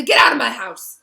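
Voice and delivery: loud furious roar. A narrator's reading. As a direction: throw him out in fury. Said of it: "said Hagrid furiously".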